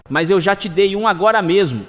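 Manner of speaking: ordinary talk, nothing marked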